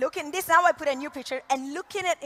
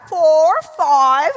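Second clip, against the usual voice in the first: "Four" and "five" in a mock British accent, louder; 8 dB louder than usual. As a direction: try the numbers in a put-on British accent. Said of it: "I tried the British accent".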